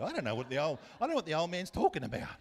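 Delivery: exasperated retort. Retold or imitated. Son answering teammates about his yelling dad, loud exasperated retort. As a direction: call it back like an embarrassed son.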